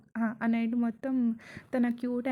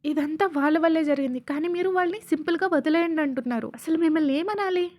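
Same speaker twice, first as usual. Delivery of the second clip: heated complaint, raised pitch and volume; loud, higher-pitched indignant rant; angry yelling; 4 semitones higher, 5 dB louder than usual. Acted. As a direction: argue back loudly, refusing to let it go. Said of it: "అని serious అవుతాడు అరుస్తూ".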